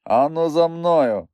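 commanding and insistent. A narrator's reading. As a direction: draw the order out, insisting. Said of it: "повторив я так владно і наполегливо".

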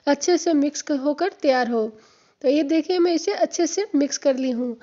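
normal talking voice

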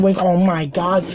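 usual speaking voice

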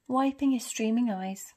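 ordinary delivery

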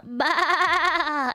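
long wavering sheep bleat. Pretend. Streamer greeting a viewer, playful sheep bleat.

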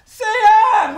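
high, loud call across the room; exasperated shout to his friend. Acted. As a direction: yell for him to get inside. Calling friend loudly to come.

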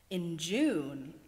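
ordinary delivery, nothing marked

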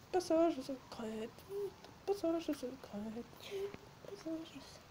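spooky echo trailing off; fading echo. Pretend. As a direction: let it fade into the distance. Repeating "passage secret" as a spooky echo.

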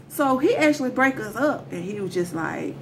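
usual speaking voice

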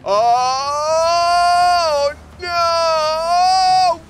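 high, loud crying out; anguished cry. Real, not acted. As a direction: wail in disbelief. Car falls off stairs.

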